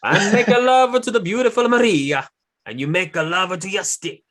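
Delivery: high, loud mock Italian accent; over-the-top Italian wrestler accent; Italian-style accent. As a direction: ham up a put-on Italian accent. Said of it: "Okay, I'm gonna do the accent".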